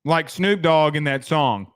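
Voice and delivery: ordinary delivery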